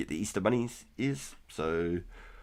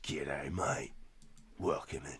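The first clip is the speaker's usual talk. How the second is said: softer, hammed-up Aussie drawl on mate; Aussie accent; 2 semitones lower, 6 dB quieter, slower than usual. Welcoming Jeremy in chat in a softer mock Aussie accent. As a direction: lean into a broad Australian twang, softer. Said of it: "That was my Aussie impression".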